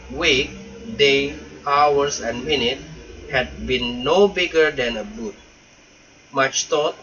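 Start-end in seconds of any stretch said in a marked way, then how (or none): none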